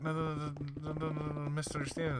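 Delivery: high-pitched frantic no's; pleading protest. Retold or imitated. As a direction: beg him off, voice jumping up. Guy protests being kicked out.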